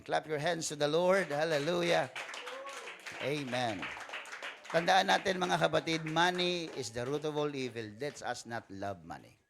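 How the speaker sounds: loud, slow preacher's call; preaching at full force. Real, not acted. Pastor rousing the congregation to praise, loud preaching. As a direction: rouse the congregation.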